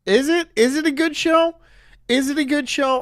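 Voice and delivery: high, loud disbelieving is it a good show; incredulous. Real, not acted. Mocking cohost who praised the show, high incredulous.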